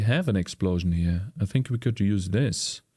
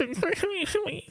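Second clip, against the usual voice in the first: high giggle; 4 dB quieter than usual. Real, not acted. Laughs after his mock voice.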